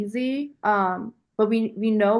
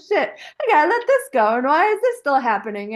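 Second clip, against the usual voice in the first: exasperated; 5 semitones higher, 4 dB louder than usual. Retold or imitated. Frustrated inner voice, retold.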